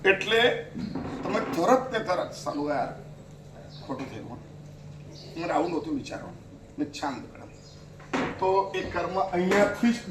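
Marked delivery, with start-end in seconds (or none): 2.3-7.4 low, soft self-reproach; quiet regretful inner voice. Pretend. Preacher voices a sinner's quiet inner regret, soft and low. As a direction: mutter it as quiet regret to yourself.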